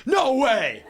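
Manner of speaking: high, loud panicked outburst; blurted refusal. Retold or imitated. Scared boy turning down a girl's dance, blurted. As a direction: blurt it out far too loud. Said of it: "all that came out of me was like".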